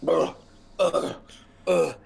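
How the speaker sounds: short throaty wordless grunts; groggy grunts. Acted. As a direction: grunt like someone dazed coming to. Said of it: "he kinda starts".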